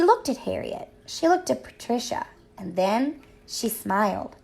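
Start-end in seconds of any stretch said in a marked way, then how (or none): none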